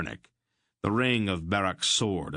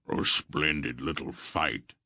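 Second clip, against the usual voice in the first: lower, slow hearty warrior voice; big warrior; 3 semitones lower than usual. A narrator's reading. Barak praises the skirmish to his friends, hearty and low. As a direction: savor the fight like a burly fighter.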